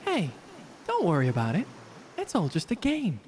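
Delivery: ordinary talk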